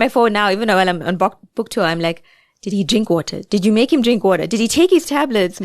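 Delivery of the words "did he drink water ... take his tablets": playful re-enactment of fretful check-up questions; fussing over parents. Retold or imitated. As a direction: imitate your own nagging questions, pitch rising as they pile up. Anxious reminders to parents, acted.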